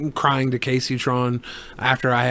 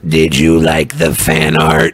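low, slow robot voice; 11 semitones lower, 5 dB louder, slower than usual. Pretend. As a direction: drone it like a robot. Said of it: "here's my robot voice".

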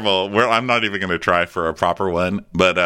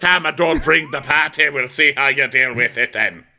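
put-on Irish lady accent; 7 semitones higher, 3 dB louder than usual. The mom sulking about withholding patties in a cartoon Irish accent. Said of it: "making her like an Irish lady".

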